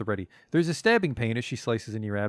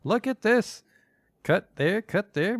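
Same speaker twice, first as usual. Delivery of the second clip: gleeful butcher crone; excited hag instructing cuts; 9 semitones higher, slower than usual. Acted. Marta excited over the body.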